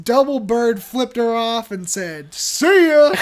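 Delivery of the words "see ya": loud, high, taunting "see ya"; mock farewell shout. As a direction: fling it back over your shoulder as you leave. Husband's parting shot to wife.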